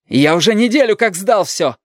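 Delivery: high, exasperated comeback; acted indignant grandson; angry retort. A narrator's reading. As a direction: protest hotly at grandma. Grandson quarrels back.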